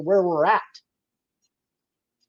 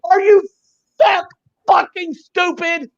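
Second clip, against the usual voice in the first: incredulous angry yell; 9 semitones higher than usual. Real, not acted. Ranting at people who blame five G, furious disbelief. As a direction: hit them with the disbelief.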